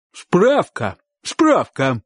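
high, emphatic repeated word; simple-minded uncle. A narrator's reading. Uncle handing the man a homemade paper, high and emphatic. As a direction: announce it proudly in two high bursts.

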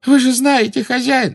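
very high, loud begging; Belatrisa wailing. A narrator's reading. Witch begs her master for forgiveness, wailing. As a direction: plead desperately, almost wailing. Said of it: "зарыдала Белатриса".

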